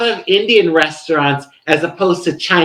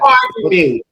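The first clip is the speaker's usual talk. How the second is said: mock posh pardon; 12 semitones higher than usual.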